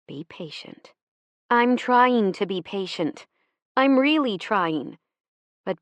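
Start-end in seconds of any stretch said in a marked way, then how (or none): 0.1-0.9 much softer, almost breathed repeat; a faded echo of the advice. Retold or imitated. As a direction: let the second plea fade right down. Mother's advice remembered.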